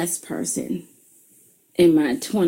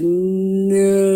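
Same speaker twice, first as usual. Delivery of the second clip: drawn-out, louder no; slow-motion no; 4 dB louder than usual. Shown as a demonstration. Mother replaying her no in slow motion for her daughter. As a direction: stretch the no out slowly. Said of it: "Let me repeat that in slow motion".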